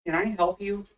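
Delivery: ordinary talk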